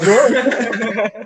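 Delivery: high squealed whoa dissolving into laughter; laughing whoa. Real, not acted. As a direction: squeal it and crack up.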